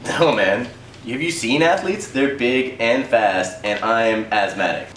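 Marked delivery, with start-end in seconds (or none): none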